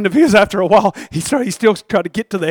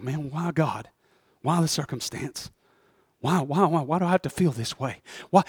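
weary questioning; 4 semitones lower, 8 dB quieter than usual. Acted. Voicing a weary believer's questions.

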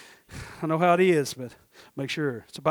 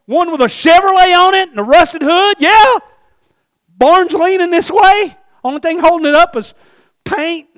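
high, loud acted exchange; excited shouted dialogue; 10 semitones higher, 11 dB louder than usual. Couple argue over buying the field, excited shouting. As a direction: call it out high, insistent and exhilarated. Acts heated husband and wife exchange.

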